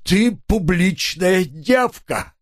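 slow, high lament; drawn-out whining. A narrator's reading. Husband abuses his leaving wife, whining slowly. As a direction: intone the insult as a long whine. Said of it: "тягуче заныл он".

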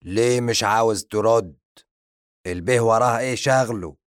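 loud indignant questions; angry questioning. Acted. The zombie scolding his servant, loud indignant questions. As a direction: demand an answer, offended. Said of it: "شعر الزومبي بالغضب".

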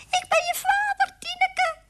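loud, very high mouse squeak; squeaky mouse. A narrator's reading. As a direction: cry out to your daughter as a mouse. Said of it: "riep hij".

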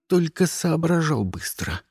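ordinary delivery, nothing marked

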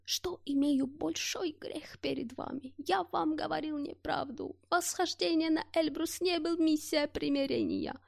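a faint dying confession; whisper. Acted. (слабый шёпот)

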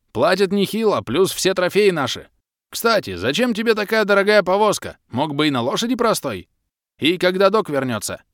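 high, loud booming half-orc over the wind; big friendly brute shouting cheerfully; hearty half-orc. A narrator's reading. Half-orc Bom shouting chat. (полуорк Бом добродушно орёт на ходу)

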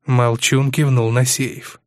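plain reading voice

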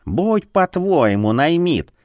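resigned, drawn-out grudging consent; sighing. A narrator's reading. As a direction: give in with a weary sigh. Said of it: "вздохнула приказчица".

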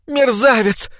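очень высоко, визгливо; заверещи оскорбление; визг. A narrator's reading. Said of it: "заверещала Паддинг". (Паддинг визжит на мужа: высокий визг)

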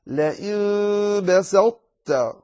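very slow "بسطت", heavy ṭāʾ held, falling at the end; emphatic ṭāʾ kept. Shown as a demonstration. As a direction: draw out "لئن بسطت" with the full heavy ṭāʾ. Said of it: "فتقول".

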